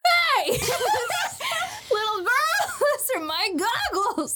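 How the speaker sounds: very high, indignant cartoon yell; hammed-up high shout through laughter; Usopp. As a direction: squeal it like an outraged cartoon boy. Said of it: "so Usopp is like".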